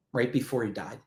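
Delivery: low, hushed repeat; somber echo. Real, not acted. Guest repeating a widow's words to host, somber echo. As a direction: let it drop soft and low, heavy with meaning. Repeats line of moving story.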